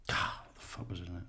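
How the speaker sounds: breathy whisper to himself, fast; whispering while trying to recall a name; whispered aside. Real, not acted. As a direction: mutter it under the breath while searching memory.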